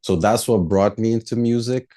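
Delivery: ordinary talk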